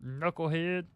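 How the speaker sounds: lightly imitated basketball pundit; half-committed impression of a TV analyst; Charles Barkley. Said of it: "teaching me the Charles Barkley".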